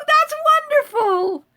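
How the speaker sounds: high, slow, sing-song that's wonderful; delighted exclaim. Real, not acted. Thrilled reply to a viewer who made jam, sung out high. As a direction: stretch it out high with real delight.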